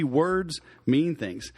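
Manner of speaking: usual speaking voice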